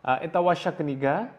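ordinary talk

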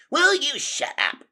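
very high, loud shout; exasperated yell. A narrator's reading. Officer rat silences his arguing crony, yelling. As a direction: cut him off with a yell. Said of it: "Redtooth yelled".

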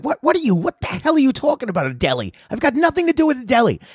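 hammed-up indignant older woman; imitating the flower lady. Host retells the flower lady's outburst, high mock indignation. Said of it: "And then she's like".

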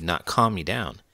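ordinary delivery

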